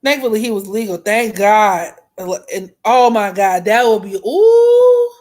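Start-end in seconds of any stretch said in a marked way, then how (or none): 4.2-5.1 high loud ooh. Real, not acted. Reacting to an imagined worse outcome.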